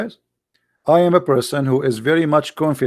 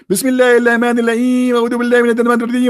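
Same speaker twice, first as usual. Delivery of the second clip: rattled off fast in a higher put-on voice; mock rote recitation; 5 semitones higher, much faster than usual. Speaker mocks a believer reciting without understanding, fast and high. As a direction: parody someone reciting by rote, racing through. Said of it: "I can recite it to you from the beginning".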